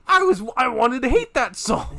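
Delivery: normal talking voice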